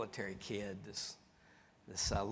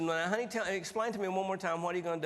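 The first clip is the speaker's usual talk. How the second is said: higher, sugary wife asking patiently; sweet wife voice; 7 semitones higher, faster than usual. Retold or imitated. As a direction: play the sweet-talking wife, pitched up.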